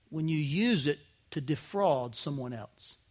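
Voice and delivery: usual speaking voice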